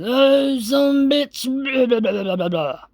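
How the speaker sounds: put-on high, slurred drunk rant; a drunk raving at nobody; drunken ranting.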